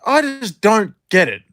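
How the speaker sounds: imitating dad. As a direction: do your dad, puzzled and fed up. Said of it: "And he's like".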